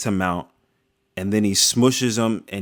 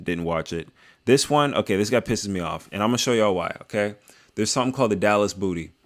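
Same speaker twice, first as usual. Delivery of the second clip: ordinary talk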